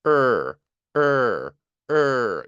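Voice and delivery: firm, held "으" like "er", tongue pulled back; fuller R sound. Shown as a demonstration. Teacher shows students the R with breath reduced, "으" solidly voiced. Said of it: "호흡의 양을 좀 줄이고 소리의 질감을 좀 더 올리면".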